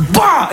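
high sharp punching grunt; effort grunt. Retold or imitated. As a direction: throw everything into the punch. Punching the machine.

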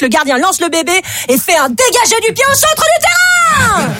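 high, shouted punchline; excited punchline. Acted. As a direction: burst out with the twist.

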